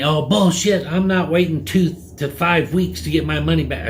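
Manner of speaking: high-pitched mock outrage; imitated heated protest; irate customer. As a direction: scoff back like a furious customer. Acting out customer's angry retort.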